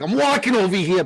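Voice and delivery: high, loud hammed-up New York accent; brash mock New York street voice. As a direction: belt it out like a cartoon New Yorker. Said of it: "do a New York accent".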